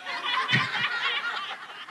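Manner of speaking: big high burst of laughter. Real, not acted. Laughing at own joke.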